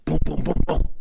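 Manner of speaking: loud booming knocks, evenly spaced; vocal pounding on a door; imitated door knocking. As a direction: punch out each knock.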